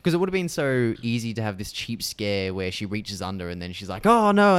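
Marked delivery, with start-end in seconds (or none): 4.1-4.6 startled cry. Retold or imitated. Heroine grabbed under couch.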